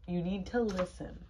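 ordinary delivery, nothing marked

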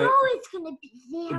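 loud, high objection; insistent protest. Real, not acted. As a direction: cut in loudly to object. Child interrupts teacher, protesting.

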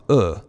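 "uh" said as a short grunt; a quick, neutral "uh" from the throat; schwa grunt. Shown as a demonstration. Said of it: "Schwa sounds like a grunt".

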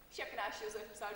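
ordinary talk, nothing marked

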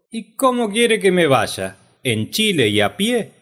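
high, loud indignant comeback; animated, high-pitched character reply; exclaiming retort. Acted. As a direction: throw the question back, voice leaping up. Acted reply of exiled Chacho.

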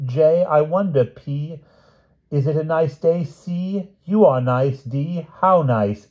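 high-pitched king reciting his love poem; romantic recitation. A narrator's reading. King reads his rewritten poem for Piggy, romantically. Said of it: "more romantically".

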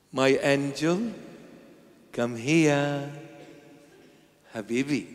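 soft, tender call; tender parent. Shown as a demonstration. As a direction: coax the child lovingly. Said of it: "Talk this way to me".

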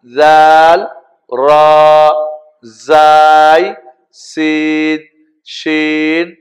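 drawn-out, level-pitched letter names; alphabet recitation. Shown as a demonstration. Teacher leading learners through the Arabic alphabet, measured recitation. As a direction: hold each Arabic letter name on a steady note.

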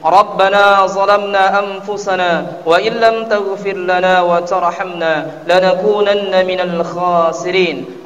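usual speaking voice

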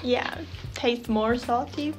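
usual speaking voice

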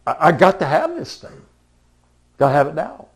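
loud, high, insistent I-must-have-it voice; craving voice. Retold or imitated. Someone voicing their craving for a new purchase, loud and urgent. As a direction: blurt it out like you can't wait.

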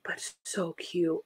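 breathy gushing whisper. Real, not acted. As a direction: whisper it in awe. Gushing over clip.

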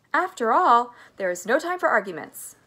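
loud, brisk brushing-aside; urgent cry. A narrator's reading. Mister Wonka stops the arguments, urgent loud cry. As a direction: cry out to cut off objections. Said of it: "cried Mister Wonka".

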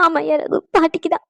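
weepy, sobbing between words; tearful. Acted. Mother answering her worried son about grandmother, crying. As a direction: answer through tears. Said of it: "ஏம்மா அழுதுகிட்டே இருக்க".

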